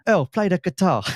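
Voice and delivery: cheesy London gangster impression, half-laughing; mock East End gangster. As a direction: mimic a terrible movie-villain East End gangster. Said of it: "really bad East End gangster off a Saturday night movie".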